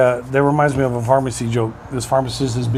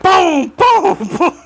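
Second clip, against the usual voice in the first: high, loud laughing boom boom; punch sound effects; 5 dB louder, much slower than usual. Retold or imitated. Telling the punchline with mock punch noises. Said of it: "And he went".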